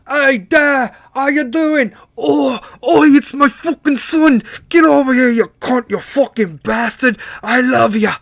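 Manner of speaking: loud, high-pitched hammed-up English accent; bad English accent. English son and dad greeting each other, hammed-up English accent. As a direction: holler it in a dodgy English accent. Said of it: "I probably didn't give the English accent".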